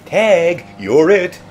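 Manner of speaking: playful taunt. Acted. Taunting the Dalek.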